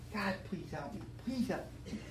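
soft, pleading prayer; retold prayer. Drinker praying to God for help, hushed retold plea. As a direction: pray it quietly, recalling the desperation. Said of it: "and I prayed".

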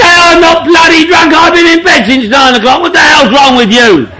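mock-furious mother bellowing; mum shouting back. Mum snapping back at her drunk son, mock angry shout. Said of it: "She'd say".

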